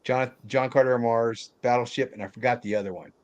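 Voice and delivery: normal talking voice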